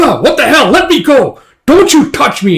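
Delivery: high, loud protest while being grabbed; outraged shouting. Retold or imitated. Guts fights being carried over a shoulder, shouting in outrage. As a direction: yell it as if hoisted off your feet.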